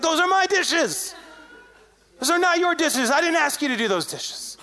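high, indignant mock scolding; mad. Angry friend defending his own dishes, retold as mad. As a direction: imitate an offended friend claiming his turf. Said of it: "He's mad".

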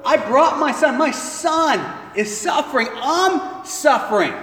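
loud, pleading yell at the crowd; a father shouting over arguing disciples; desperate father shouting. Acted. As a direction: cut through the bickering. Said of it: "tells everyone to shut up".

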